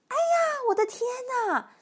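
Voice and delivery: put-on high, startled old-lady outcry; high-pitched "oh my goodness" panic; frightened cry. A narrator's reading. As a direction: shriek in mock terror, storybook style. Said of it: "瘦小的老妇人喊起来".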